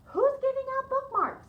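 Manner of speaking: high and loud in disbelief; surprised question. Acted. Missus Page asks in loud, high surprise who hands out bookmarks. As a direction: ask it as if caught off guard.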